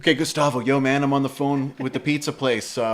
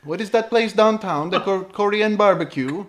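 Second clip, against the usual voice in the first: put-on higher Colombian accent, earnest question; Gustavo; 7 semitones higher than usual. Bandmate ordering off-menu at the pizza place, higher Colombian accent. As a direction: imitate a Colombian friend puzzling over a restaurant. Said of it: "my very best impression of him".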